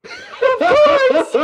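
high, loud laughing burst; shrieking with laughter; laughing loudly. Real, not acted. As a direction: crack up in full. Laughing fit.

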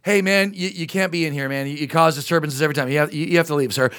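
low, hesitant, stammering request; meek employee. Acted. Employee asking troublesome customer to leave, low and hesitant. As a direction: ask apologetically, keeping it low-key.

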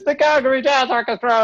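mock stage announcement, high; announcer. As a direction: call it like an emcee. Announcer calls band on.

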